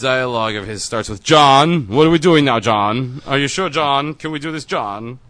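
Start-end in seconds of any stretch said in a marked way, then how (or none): 1.2-5.2 higher-pitched villain with a bad Russian accent; mock Russian by way of Finnish, pleading; atrocious Russian-Finnish accent. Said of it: "Russian via a atrocious Finnish accent".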